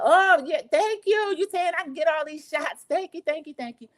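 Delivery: high, gushing imitation of a grateful guest; high-pitched put-on gratitude; effusive thanks. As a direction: pile on the thanks in a mock-excited voice.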